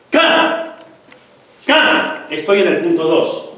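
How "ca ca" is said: ca barked out much higher and louder, air pushed hard behind the k; compressed ca. Shown as a demonstration. Teacher demonstrating compressed air with loud high ca. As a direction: spit out ca on a burst of held air. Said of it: "porque yo te hago".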